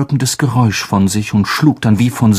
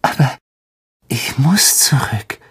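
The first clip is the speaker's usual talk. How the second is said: fassungslos flüstern; tonlos, erschrocken; geflüstert. Acted. Harry shocked whisper. (Harry flüstert erschrocken zu Dobby)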